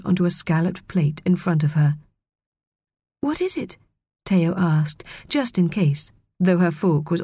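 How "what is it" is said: timid question. A narrator's reading. Timid guest asks about food.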